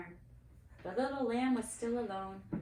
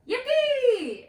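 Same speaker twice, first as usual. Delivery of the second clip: far higher, much louder cheer; 16 dB louder than usual. A narrator's reading. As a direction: whoop for joy. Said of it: "Aaron cheered".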